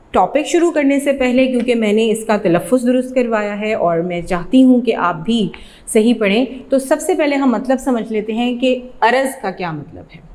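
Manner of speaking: usual speaking voice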